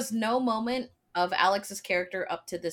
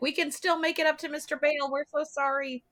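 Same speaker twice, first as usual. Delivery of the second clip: high, fast mock pleading; hammed-up desperate apology; frantic aunt; 8 semitones higher than usual. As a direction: babble an apology to the boss. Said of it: "She's like".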